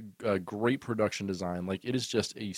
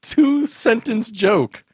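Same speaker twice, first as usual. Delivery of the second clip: outraged protest; 13 semitones higher, 12 dB louder than usual. Real, not acted. Defending joke length, outraged.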